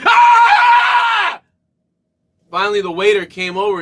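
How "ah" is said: sudden shrieking yelp much higher and louder; startled scream. Retold or imitated. To his wife as each customer enters, shrieking scream. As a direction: scream in mock panic. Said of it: "I looked up at my wife and I went".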